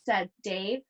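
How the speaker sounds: put-on lively read-aloud voice for children; story reading. Teacher reading a picture book to her class, animated storytelling.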